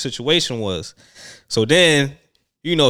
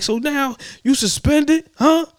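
high, shrill-pitched angry mom imitation; angry mother; 13 semitones higher, 5 dB louder than usual. The kid's mother confronting her son in the hallway, a high mock scolding.

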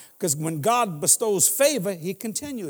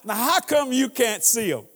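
ordinary delivery

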